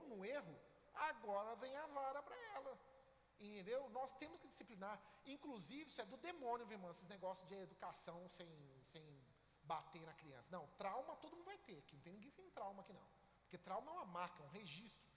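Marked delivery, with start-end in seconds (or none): none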